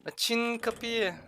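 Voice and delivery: high, drawn-out plea; calling out. Real, not acted. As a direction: stretch it out slowly in a high call. Kapının açılması için sesleniyor. (tiz sesle uzatarak seslenme)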